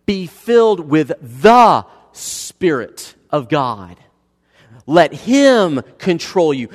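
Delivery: loud, slow, emphatic scripture reading; preaching at full force. Acted. Pastor thundering Paul's words to the church, slow and loud. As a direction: proclaim each phrase slowly and loudly.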